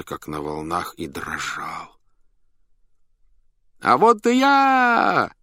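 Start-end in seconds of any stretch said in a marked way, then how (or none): 3.8-5.3 very high, drawn-out clown's holler; loud shout. A narrator's reading. Master announces himself to the circus crowd, loud shout. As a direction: cry it out slowly at full voice, way up high. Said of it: "громко крикнул хозяин".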